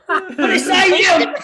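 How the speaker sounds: ordinary talk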